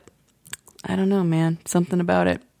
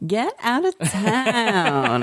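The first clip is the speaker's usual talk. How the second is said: mock disbelief; 5 semitones higher, much slower than usual. Real, not acted. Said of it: "reacting to blonde detail".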